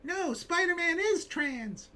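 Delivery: high, slow, whiny mock voice; mock fan voice. Creator parodying a fan on a panel, high whiny mockery. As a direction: lampoon the earnest fan.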